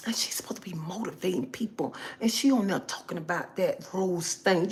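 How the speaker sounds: soft, disapproving whisper; gossiping whisper. Acted. Viewer complaining about the motivator's live, hushed gossip. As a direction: whisper disapproval behind her back.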